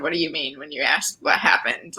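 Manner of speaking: whispered; hushed mom quote under the breath; retold whisper. Retelling mom's puzzled question in a whisper.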